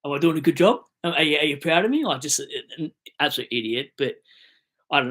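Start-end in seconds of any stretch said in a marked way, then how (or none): 0.1-2.1 mock eager kid. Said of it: "looking at my old man like".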